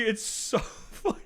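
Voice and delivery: whispered giggling through the words; airy giggle, slow; breathless laugh. Real, not acted. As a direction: laugh so hard the voice drops out. Breaks down laughing.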